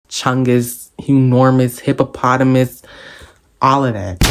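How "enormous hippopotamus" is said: ordinary delivery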